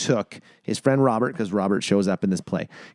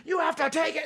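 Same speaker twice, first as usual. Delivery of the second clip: urging director; 7 dB quieter than usual. Retold or imitated. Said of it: "behind me going".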